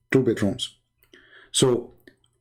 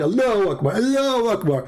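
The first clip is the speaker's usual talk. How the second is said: mocking; 8 semitones higher than usual. Mocking the cheering Muslims.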